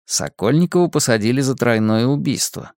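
ordinary delivery

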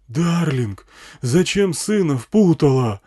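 high, slow bullhorn reproach; loud, drawn-out amplified shout; yelling through megaphone. A narrator's reading. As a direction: reproach her across a whole desert. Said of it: "орал мегафон на всю пустыню".

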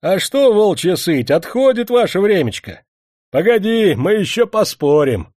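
foundry worker jeering at the master; mocking shout. A narrator's reading. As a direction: jeer at him loudly. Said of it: "насмешливо крикнул".

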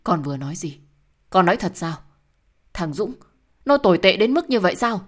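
rising to a loud, high cry of shock; can't believe what she hears; shocked disbelief. Acted. Mother hears of the son-in-law's betrayal, loud shocked cry.

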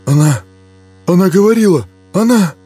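terrified. Acted. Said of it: "шептали трясущиеся губы".

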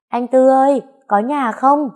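high, loud call to the house. Acted. Bà Bảy calls loudly from the gate into the house. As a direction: call out across the yard to the gate.

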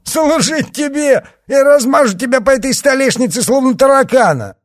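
loud, high jeering yell; mocking laughing shout. A narrator's reading. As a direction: roar with scornful laughter. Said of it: "расхохотался он".

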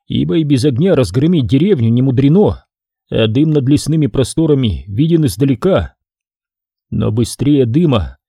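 ordinary delivery, nothing marked

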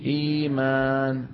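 إيمان recited slowly with a long ee; slow recitation. Shown as a demonstration. Teacher reciting the model word slowly for the class. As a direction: intone إيمان slowly, stretching the ee. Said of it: "شو بيقولوا؟".

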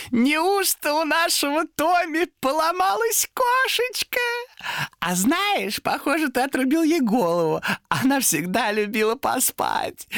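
slow, very high mock baby-talk; gloating demon. A narrator's reading. Demon teases Томми over his beheaded cat, gloating. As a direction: gloat shrilly, breaking into a nasty laugh. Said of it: "Демон зашёлся мерзким хохотом".

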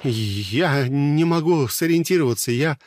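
lost and frightened; panicked confusion; confused panic. A narrator's reading.

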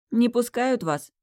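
slow, teasing question; mocking smirk. A narrator's reading. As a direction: smirk at the drunks. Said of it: "усмехнулась я".

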